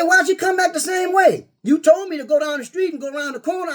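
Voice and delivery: high shouted scolding at the coworker; yelling at the clueless coworker; retold shouting. Coworker came back the wrong way, so he shouts at him.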